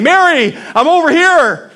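high, loud, hammed-up calling across the garden; over-the-top pretend yell; mock frantic shouting. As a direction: play it like someone leaping and waving for attention. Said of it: "jumping and screaming and waving his arms".

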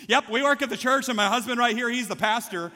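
high, loud, imitated wifely blurt; blurted announcement. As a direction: proudly announce it, imitating wife. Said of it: "she just comes right out and says it".

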